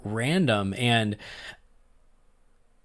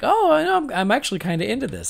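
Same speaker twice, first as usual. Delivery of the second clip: excited; 9 semitones higher, faster than usual. Retold or imitated. To co-hosts, retelling her early enjoyment, in a high quick gush. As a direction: gush with surprised delight. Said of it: "I was like".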